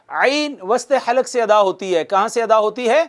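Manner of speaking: normal talking voice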